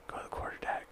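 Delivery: whispered order. Retold or imitated. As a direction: hiss the order under breath. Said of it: "he's like".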